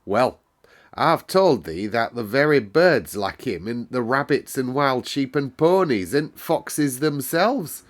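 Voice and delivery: put-on a cheerful Yorkshire servant girl telling the weather; Yorkshire maid Martha. Housemaid Martha explains the moor weather to Mary, lively Yorkshire lass.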